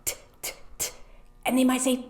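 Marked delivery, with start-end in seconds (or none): 0.0-0.9 "t" whispered as a crisp unvoiced t-t-t; repeated whispered "t"; whispered t sound. Shown as a demonstration. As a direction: tap out "t" softly with breath only. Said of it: "something that starts with a t".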